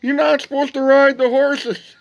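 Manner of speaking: high, loud holler with drawn-out words; screaming security guard. Retold or imitated. Security guard yelling at riders across the plaza, screaming. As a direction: yell it as if across a wide plaza. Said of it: "one of the security guards screaming".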